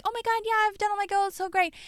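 high gushing client voice; lightly voiced, airy client bragging. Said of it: "they're like".

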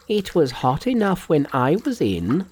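Monkey's character voice. A narrator's reading. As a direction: puzzle it out in a high voice. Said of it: "thought Monkey to himself".

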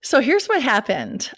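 usual speaking voice

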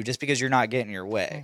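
ordinary talk

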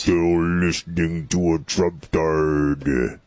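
low, slow creepy taunt. Acted. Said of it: "using my creepy voice".

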